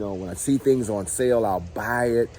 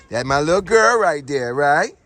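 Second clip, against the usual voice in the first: doting pet talk; 7 semitones higher, 8 dB louder than usual. Real, not acted. Talking to his dog.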